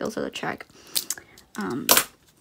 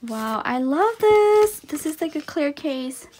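excited; 5 semitones higher, 5 dB louder than usual. Real, not acted. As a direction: gush with delight. Clear case.

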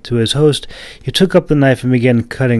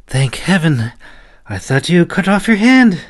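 put-on much higher old woman's voice, relief pouring out; relieved old woman; 9 semitones higher, 4 dB louder than usual. A narrator's reading. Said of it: "breathed out a sigh of relief".

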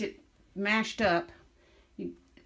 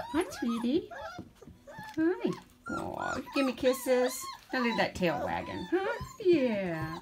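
high cooing greeting; 11 semitones higher, slower than usual. Real, not acted. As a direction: coo at the puppy. Greeting puppy.

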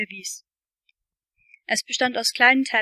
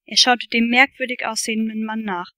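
ordinary delivery

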